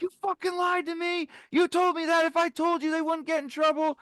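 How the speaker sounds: yelling betrayal at the police officer; retold furious yell. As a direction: scream at the cop who tricked you. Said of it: "talk aggressively?".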